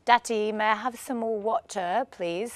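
put-on a child's prim little English accent, lightly done; light mock English accent. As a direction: mimic a posh English child asking nicely. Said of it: "started talking in an English accent".

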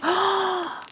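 high, soft gasp of surprise; delighted gasp. Real, not acted. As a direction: gasp in wonder at a lovely find. Sees crystal cloth.

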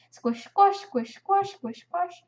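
high, bouncy squish-squash chant; playful high blob sound effect; imitated blob squishing. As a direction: chirp the squelches in rhythm. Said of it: "The sounds of blobs".

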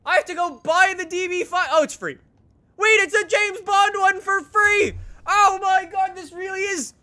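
high, loud thrilled outburst; excited discovery. Real, not acted. Player finding a free Bond car, excited. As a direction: gush with real astonished delight.

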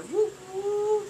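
high, cheering fan whoops; high-pitched mock concert cheer; cheering woo. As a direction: whoop like in the crowd.

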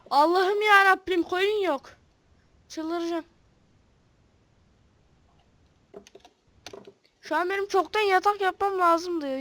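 frustrated crying out to God; exasperated. Real, not acted. Frustrated, cannot find sheep.

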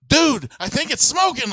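high, loud alarmed call; retelling a startled warning; alarmed neighbor. Retold or imitated. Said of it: "the guy next to me was like".

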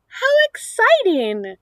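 bright, high burst of excitement; excited. Real, not acted. Teacher hyping kindergarten to child.

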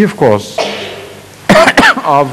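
usual speaking voice

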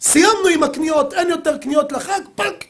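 mock angry yell at the wife; retold outburst. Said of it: "והוא התפרץ עליה". (התפרצות כעס מחוקה של הנהג)

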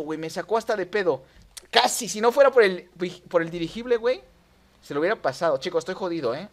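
ordinary talk

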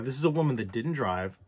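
normal talking voice

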